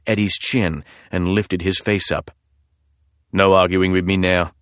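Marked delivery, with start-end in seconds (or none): 3.3-4.5 put-on husky British accent. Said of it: "a man's husky voice".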